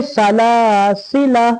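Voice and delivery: "सलासिला" with middle laa drawn out, last la short; middle elongation. Shown as a demonstration. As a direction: pull the first alif of "सलासिला" long. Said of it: "यहाँ खींचे".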